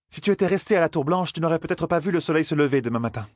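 high-pitched lecturing character voice; condescending Aes Sedai. Acted. Aes Sedai warning the heiress of danger, high condescending voice. Liandrine warns Elaine in story.

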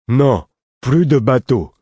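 slow, loud, high-pitched protest; stubborn refusal. A narrator's reading. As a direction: refuse like a child digging in. Lourd refuses the boat.

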